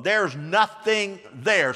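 slow emphatic line. Shown as a demonstration. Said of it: "Say that with me".

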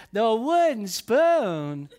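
put-on slow, giggly, delighted child's voice; mock-gleeful little kid laughing; laughing toddler. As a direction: laugh through the words like a gleeful two-year-old. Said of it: "busting out laughing and said".

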